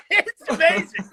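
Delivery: can't stop laughing as he says it. Real, not acted.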